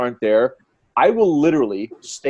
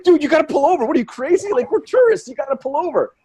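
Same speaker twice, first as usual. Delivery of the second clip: high, agitated mock pleading; exasperated urging; 12 semitones higher, 3 dB louder than usual. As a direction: plead with a reckless driver, pitched way up. Acting out passenger urging the driver.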